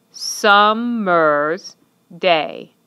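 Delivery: "Summer's day" very slow, each syllable separate. Shown as a demonstration. Teacher counts syllables for students, saying each one slowly apart. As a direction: clap out "Summer's day" as sum-mer's, day. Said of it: "figure out how many syllables each word has".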